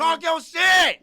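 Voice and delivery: loud, high, slow cheering yell; hype shout. Real, not acted. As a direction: yell it to egg him on. Hype man egging the guest on.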